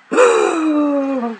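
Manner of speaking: high dramatic gasp; mock gasp. Teasing girlfriend with a mock gasp. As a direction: gasp in fake surprise. Mock shock about Timmy.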